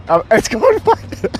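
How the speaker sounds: slow giggly delivery; laughing. Real, not acted. Stream viewers wave, he cracks up.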